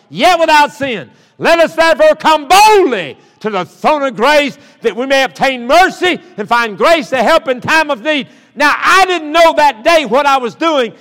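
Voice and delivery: high, loud, driving sermon delivery; full-force preaching. Real, not acted. Preacher urging the congregation to come to Jesus, full-force preaching. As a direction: ring out the scripture and exhortation, sustained and emphatic.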